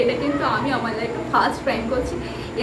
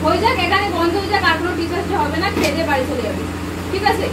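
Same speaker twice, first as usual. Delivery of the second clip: mock scolding; 4 semitones higher than usual. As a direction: pretend to blame them, pitched up. Fake scolding during the prank. (ভান করে বকুনি, উঁচু গলায়)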